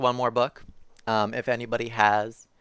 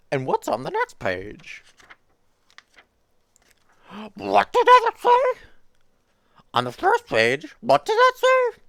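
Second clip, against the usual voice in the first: very high, louder, anxious furry monster; Grover voice; 5 dB louder than usual. Acted. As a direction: plead with the reader in a high puppet squeak. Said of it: "tried to do the Grover voice".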